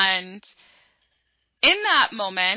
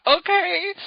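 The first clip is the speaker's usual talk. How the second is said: nervous gasp; 8 semitones higher, 6 dB louder, slower than usual. Retold or imitated. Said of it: "And I was like".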